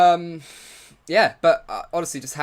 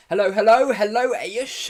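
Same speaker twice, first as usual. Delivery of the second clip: bright, much higher and louder hellos; excited greeting; 10 semitones higher, 10 dB louder than usual. Real, not acted. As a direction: call out happily to a new viewer.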